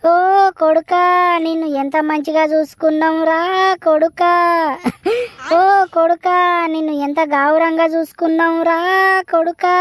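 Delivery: tearful sing-song wail, each కొడుకా cried out; crying lament. Acted. Mother wailing over her son while neighbours try to calm her, sobbing lament. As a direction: mourn him in rhythmic sobbing calls. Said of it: "ఇది ఏడుపు బంద్ చేయదు కానిగా".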